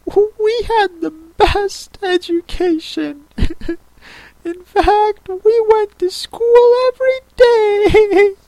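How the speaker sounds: very high, slow, tearful warble; mournful Mock Turtle. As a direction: wail slowly like a weepy turtle.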